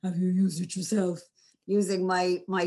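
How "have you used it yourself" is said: low pitch. Shown as a demonstration. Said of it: "you've obviously got pitch".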